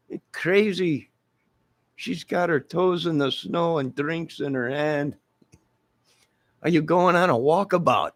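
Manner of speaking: put-on Australian accent, comic banter; mock Australian locals. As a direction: voice passing locals chatting. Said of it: "They would have came from miles around".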